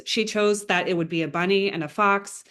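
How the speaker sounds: ordinary talk, nothing marked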